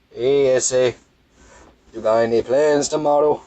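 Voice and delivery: a light, joking stab at a Mexican-Spanish accent; mock Spanish accent. Shown as a demonstration. Trying the joke accent a second time, lightly hammed-up. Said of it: "like, that was bad".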